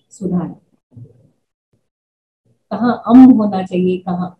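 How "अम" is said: अम said as a syllable closing on an m sound; Pali pronunciation. Shown as a demonstration. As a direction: show the Pali sound अम, ending on m. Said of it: "उन्होंने मेरा पाली उच्चारण". (पाली उच्चारण में अम, म् की ध्वनि पर खत्म)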